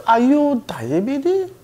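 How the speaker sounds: high, slow mock nurse question; cheerful nurse. Nurse asking if he is diabetic, high and slow. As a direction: ask it brightly, slowly. Nurse mangling his name.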